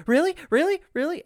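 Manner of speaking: put-on high, rapid child's disbelief; excited kid. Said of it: "and Lily's like".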